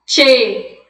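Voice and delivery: CHE said "tche", with a T onset; Spanish CH sound. Shown as a demonstration. Teacher modelling the Spanish CH for students, "tche". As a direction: punch out CHE as gaúcho "tchê". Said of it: "A gente vai ter o som".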